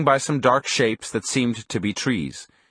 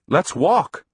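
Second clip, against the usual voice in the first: higher-pitched urgent plea; frantic plea; 6 semitones higher than usual. A narrator's reading. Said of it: "I screamed frantically".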